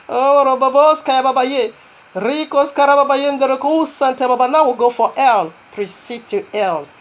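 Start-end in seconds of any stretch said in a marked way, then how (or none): 0.1-4.5 speaking in tongues. Real, not acted. Preacher breaks into tongues.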